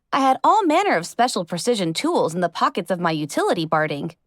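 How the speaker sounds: ordinary delivery, nothing marked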